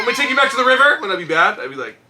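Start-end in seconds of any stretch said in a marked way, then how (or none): 0.0-1.0 higher, louder, rushed mock threat; mock threatening mom. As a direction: retell mom's warning in a fast raised voice. Mother's threat retold, laughing.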